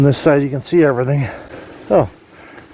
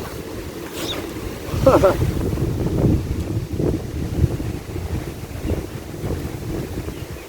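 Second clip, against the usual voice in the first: long breathy laugh; 6 semitones higher, 7 dB quieter than usual. Real, not acted. Laughing alone in the wind.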